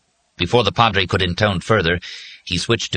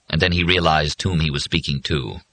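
usual speaking voice

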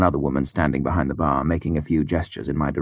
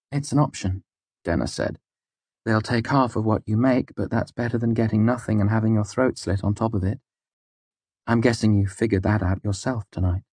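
higher, frank woman; young woman voice; 5 semitones higher than usual. A narrator's reading. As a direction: speak plainly as Denna. Narrator voices Denna.

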